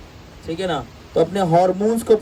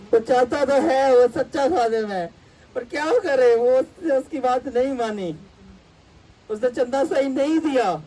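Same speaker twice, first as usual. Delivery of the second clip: lightly mocking pity, higher pitch; 7 semitones higher than usual. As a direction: whine it as if feeling sorry for him. Mock pity for the prophet. (ترس کھاتی ہوئی طنزیہ اونچی آواز)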